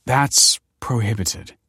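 slow, slightly nervous refusal. A narrator's reading. Kelsey refusing a forbidden request, mildly nervous. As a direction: hesitate over it, a touch uneasy. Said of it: "said Kelsey nervously".

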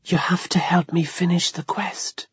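urgent ghostly whisper; hushed, voiceless plea from a ghost. A narrator's reading. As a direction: whisper it like a secret mission. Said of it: "Dead Boy whispered".

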